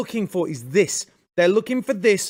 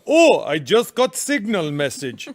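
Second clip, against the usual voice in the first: hammed-up Iranian-accented English; mock Iranian Ayatollah. Guest playing the Ayatollah reading Signal, mock Iranian accent. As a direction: put on a comic Iranian accent. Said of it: "Even his Farsi is better than your British accent".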